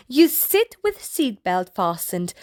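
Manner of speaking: plain reading voice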